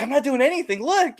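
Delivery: high-pitched mock denial; protesting boyfriend. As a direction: imitate a man caught out, voice way up. Boyfriend's protest, acted again.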